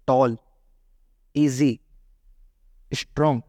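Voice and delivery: टॉल, ईजी, स्ट्रॉन्ग each as one separate unit; said in one piece. Shown as a demonstration. Teacher showing single-syllable examples, each said in one piece. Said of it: "एक टुकड़े में उच्चारण कर रहे हो".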